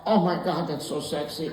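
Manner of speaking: imitation of a swooning wife; smitten wife blurting. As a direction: softly gush as the smitten wife. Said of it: "my wife blurts out".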